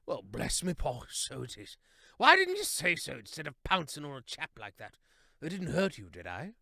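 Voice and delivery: a hint of a hearty old chap; cheerful and a touch surprised; lightly voiced jolly hare. A narrator's reading.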